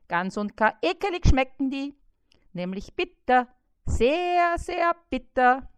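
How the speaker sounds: high, loud, whiny fly yelling insults; nagging fly. A narrator's reading. Trapped fly Bissi nags and insults the spider, high and loud. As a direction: pester the spider without pause.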